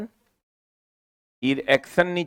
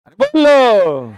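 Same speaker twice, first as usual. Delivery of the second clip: impatient urging; 5 semitones higher, 6 dB louder, much slower than usual. Real, not acted. Teacher urging class to answer.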